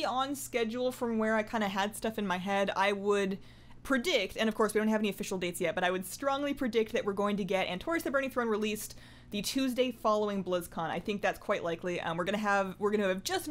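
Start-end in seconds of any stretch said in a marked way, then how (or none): none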